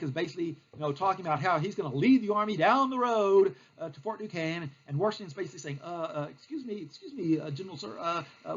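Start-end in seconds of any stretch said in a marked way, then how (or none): 5.8-8.6 soft, hesitant, halting politeness; small hushed voice, stumbling over uhs; timid interruption. Acted. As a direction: meekly try to get a superior's attention. Timid Washington interrupts the general.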